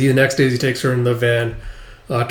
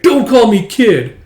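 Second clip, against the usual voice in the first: high, loud, slow protest; girl protesting being called kid; annoyed snap; 10 semitones higher, 7 dB louder, slower than usual. Acted.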